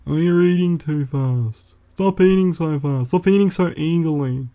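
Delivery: a whingeing god scolding someone's table manners; whining God. Retold or imitated. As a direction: nag like a petty, fussy god at the dinner table. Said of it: "hearing him whinge constantly".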